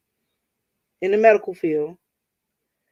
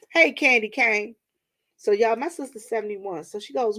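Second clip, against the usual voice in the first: ordinary talk, nothing marked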